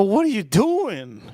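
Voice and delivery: high, drawn-out mock disbelief; baffled. Boyfriend questioning the powdered dog food, baffled.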